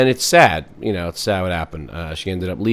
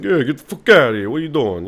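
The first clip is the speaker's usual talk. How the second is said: louder, higher mock Italian-American accent; hammed-up Italian accent, pitched up; 6 semitones higher, 4 dB louder than usual. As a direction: do a brash Italian accent for laughs. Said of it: "give things an Italian accent".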